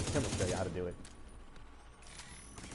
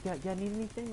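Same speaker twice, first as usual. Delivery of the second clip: friendly prop guy; 10 semitones higher than usual. Retold or imitated. Prop guy drives by offering help.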